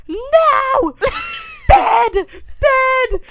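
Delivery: high, loud tantrum shrieks; shouted toddler demands, very high; freaking-out toddler. Retold or imitated. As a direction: imitate a screaming toddler refusing everything. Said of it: "She's freaking out".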